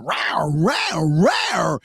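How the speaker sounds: a string of mock shark roars, the last one shouted. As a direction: growl at the boat like a bull shark. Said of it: "they're going".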